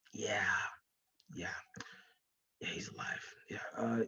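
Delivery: hushed, secretive whisper; conspiratorial whisper, very soft. Acted. Confiding a secret claim.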